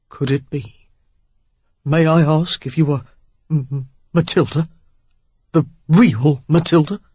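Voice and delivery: stammering, barely restrained thrill; excited stutter. A narrator's reading. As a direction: stumble over the name, eager. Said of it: "he stuttered in barely restrained excitement".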